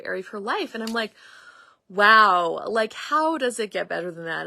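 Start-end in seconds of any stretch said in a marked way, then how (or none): 1.9-4.5 excited wow. Retold or imitated. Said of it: "And I was so excited".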